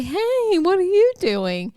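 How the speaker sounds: high, lilting mock surprise; surprised ladies. Workers greeting the boy in the back, high and surprised. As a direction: sing it like startled store ladies.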